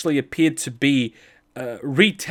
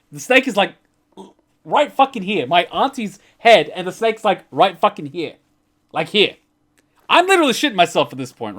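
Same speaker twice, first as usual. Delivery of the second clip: higher and louder, alarmed; telling how close the snake came, higher and louder in alarm; excited retelling; 5 semitones higher, 5 dB louder than usual. Retold or imitated. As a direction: relive the snake inches from her face. Snake right at auntie's face.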